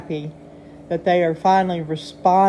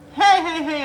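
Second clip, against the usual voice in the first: excited hey; 8 semitones higher, 7 dB louder than usual. Real, not acted. Excited greeting to chat.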